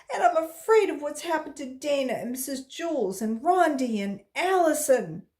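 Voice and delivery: high, loud tearful outburst; crying. A narrator's reading. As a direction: pour it out sobbing. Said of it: "Jenny started to cry".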